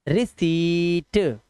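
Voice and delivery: रिसीट said very slowly as ri-seet; slow demo. Shown as a demonstration. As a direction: stretch out रिसीट, no p sound. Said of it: "पढ़ो इसको". (धीमे से रिसीट, बिना प के)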